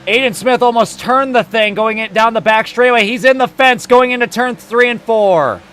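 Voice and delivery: loud, high crash call; live play-by-play. Real, not acted. Announcer calling a car into the fence, loud urgent play-by-play. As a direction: shout the action as it unfolds.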